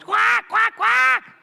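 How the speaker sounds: very high, loud repeated quacks; eager duck squawking. Retold or imitated. One duckling begging hardest for bread, loud squawking.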